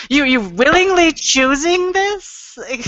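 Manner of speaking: high-pitched, appalled disbelief; hammed-up incredulous questioning; mock incredulity. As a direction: question them as if utterly baffled.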